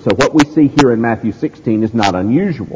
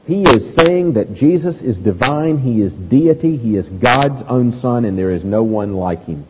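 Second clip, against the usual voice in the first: ordinary talk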